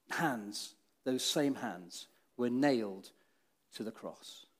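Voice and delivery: soft, slow and grave; hushed sorrow. Acted. Preacher telling the nailing of hands, soft sorrowful hush. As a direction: let the words sink in sorrow.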